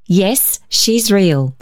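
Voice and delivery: plain reading voice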